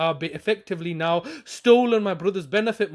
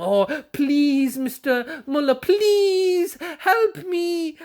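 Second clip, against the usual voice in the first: higher hammed-up whimpering plea, drawn out at the end; mock pleading; 6 semitones higher, much slower than usual. Vlogger mocking himself begging Mister Muller, hammed-up whimper. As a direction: beg like a pitiful petitioner, overdone.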